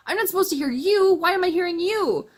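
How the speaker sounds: high, loud panicked outburst; shocked character hearing a voice in her head; alarmed disbelief. Retold or imitated. Startled character hearing someone in her head, high and loud.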